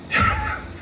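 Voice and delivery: a breathy chuckle; short laugh. Real, not acted. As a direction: laugh at the absurdity. Laughs after Trump impression.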